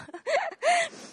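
high, light laugh through emotion; embarrassed teary laugh. Real, not acted. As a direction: laugh off the tears. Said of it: "I'm getting emotional".

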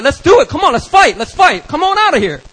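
loud, high-pitched shouting; acted fired-up yelling; fight challenge. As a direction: goad him into a fight.

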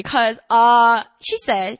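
ordinary talk

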